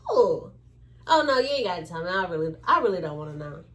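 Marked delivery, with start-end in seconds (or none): none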